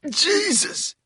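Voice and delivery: a high, loud scream of pain; agonized cry. A narrator's reading. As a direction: cry out as a thumb digs into the wound. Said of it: "he cried".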